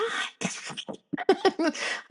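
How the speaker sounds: soft breathy high giggle; childlike giggle. Acted. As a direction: let the inner child giggle. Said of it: "the inner child me is like".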